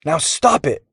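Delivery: high-pitched cross order; a storybook foot-stomping demand; bossy demand. A narrator's reading. Boy to goggled kid, stomping, bossy. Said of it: "Jax stomped a boot".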